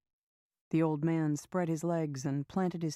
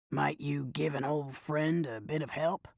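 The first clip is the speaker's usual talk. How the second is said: put-on raspy old man; 2 semitones lower than usual. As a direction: beg like a worn-out old panhandler. Old panhandler begging.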